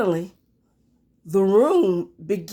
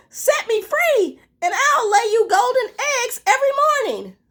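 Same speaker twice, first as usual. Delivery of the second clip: much higher hen voice, quick pleading; 3 dB louder than usual. Acted. Hen bargaining with Jack, high and pleading. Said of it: "she clucked".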